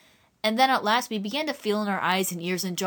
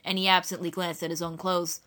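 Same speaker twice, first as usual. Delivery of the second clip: ordinary delivery, nothing marked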